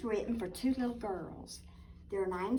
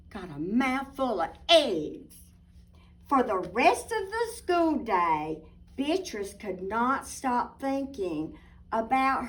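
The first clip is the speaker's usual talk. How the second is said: story narration; 8 dB louder than usual. A narrator's reading. Reads picture book aloud.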